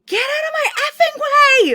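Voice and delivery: very high, loud scream with a laugh in it; frantic mother screaming at a man in a cart; retold scream. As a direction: laughingly scream out the car window. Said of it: "I just start screaming".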